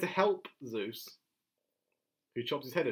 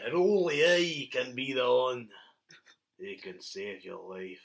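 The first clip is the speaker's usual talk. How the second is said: slow, mock-epic pronouncement; grand blacksmith character; 4 semitones lower than usual. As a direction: draw it out grandly, dropping low at the end. Acted blacksmith makes grand boast.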